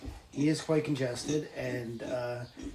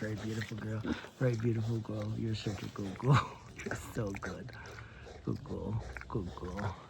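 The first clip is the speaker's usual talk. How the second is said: low, soft soothing pet talk; 4 semitones lower, 7 dB quieter than usual. Real, not acted. Soothing the pig softly, low and quiet. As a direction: murmur gently to calm her.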